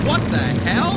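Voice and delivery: loud startled exclamation; startled outburst; shocked. Acted. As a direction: blurt it out in alarm. Shocked at the sight outside.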